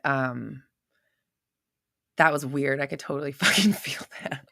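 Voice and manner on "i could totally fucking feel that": whispered, half laughing; awed whisper. Real, not acted. Host whispering to viewers about a strange energy felt. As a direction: breathe it out in disbelief.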